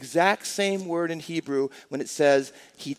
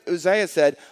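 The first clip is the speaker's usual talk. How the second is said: ordinary delivery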